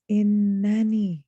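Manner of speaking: Innani stretched slowly on the doubled n; slow, weighty Innani; double emphasis. Shown as a demonstration. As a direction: hold the n in Innani, then let it fall. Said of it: "that double emphasis".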